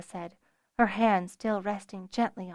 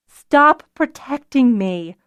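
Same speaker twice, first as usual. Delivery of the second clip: high, loud, slow protest; insistent; 5 semitones higher, 9 dB louder, slower than usual. A narrator's reading. Nuella objects to Zennor protecting her, insistent. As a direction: insist on each word firmly. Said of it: "Nuella insisted".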